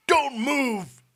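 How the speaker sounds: very loud, high warning; commanding shout. A narrator's reading. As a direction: freeze everyone with a shout. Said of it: "shouted the Potter".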